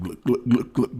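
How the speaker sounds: glugging like a big jug emptying; gulping, glugging mock laugh; imitated gulping laugh. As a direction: mimic an annoying laugh as repeated glugs. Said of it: "Dale's laugh sounds like".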